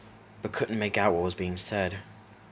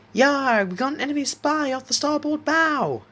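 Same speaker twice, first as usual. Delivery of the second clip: very high, excited child playing pirate; yelled pirate lookout; 6 dB louder than usual. A narrator's reading. Isaac spots the enemy spy while playing pirates, yelling high. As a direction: yell it high like a make-believe pirate. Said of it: "Isaac yelled, captivated by fantasy".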